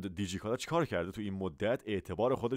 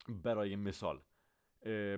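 usual speaking voice

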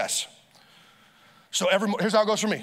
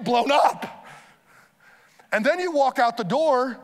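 higher, louder impassioned preaching; preaching at full force; 6 semitones higher, 3 dB louder than usual. Acted. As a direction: drive the point home from the pulpit.

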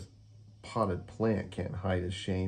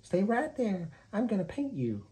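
high, sweet kitten voice; a little cat admiring her model; Kitty voice; 12 semitones higher than usual. Acted. Kitty character voice.

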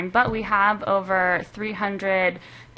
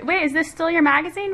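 higher-pitched imitated double take; puzzled readers; 9 semitones higher than usual. Editor mimics readers not recognizing the redesign, puzzled. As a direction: voice a confused reader's surprised question.

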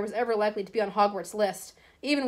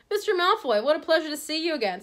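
higher-pitched obsequious greeting; fawning shopkeeper; 7 semitones higher than usual. Acted. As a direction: gush over an important customer.

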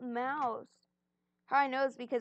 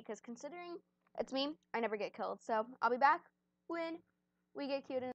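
ordinary delivery

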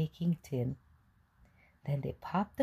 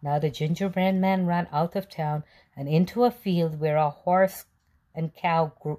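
usual speaking voice

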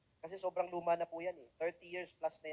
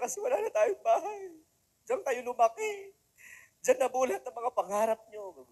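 high, slow, mock tearful wail; imitating crying mother; 7 semitones higher, 6 dB louder than usual. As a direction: play the weeping mom, half laughing. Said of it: "Iniyakan niya naman yan". (ginagaya ang umiiyak na nanay, mataas at mabagal)

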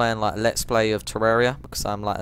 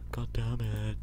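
whispered curse; 8 dB quieter, slower than usual. Real, not acted. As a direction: breathe the curse out unvoiced.

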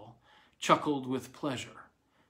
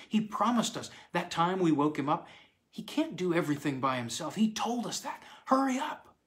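put-on lightly raised childlike voices, eager; two kids plotting, pitched up a little; children's voices; 6 semitones higher than usual. Children whispering plans at night.

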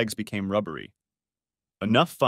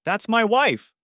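indignant shout; 12 semitones higher, 4 dB louder, slower than usual. Acted. Dave defends his wife.

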